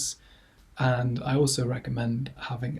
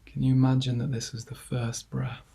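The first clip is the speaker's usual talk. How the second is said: guided meditation; 5 dB quieter than usual. Acted. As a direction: ease listeners gently into calm, leaving long silences between phrases. Leads a body scan.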